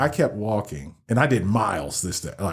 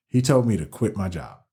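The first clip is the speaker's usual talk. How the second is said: usual speaking voice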